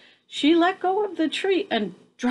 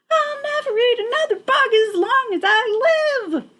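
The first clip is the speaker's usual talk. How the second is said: high, bright bat cheer; 12 semitones higher, 3 dB louder than usual. A narrator's reading. Young bat cheering while stuffing herself, high voice. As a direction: cheer it with a full belly. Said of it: "cheered Stellaluna".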